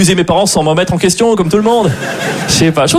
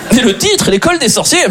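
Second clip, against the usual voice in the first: very high mock revelation; amazed reader; 8 semitones higher than usual. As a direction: build excitement over an obvious clue. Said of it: "je suis là, wow!".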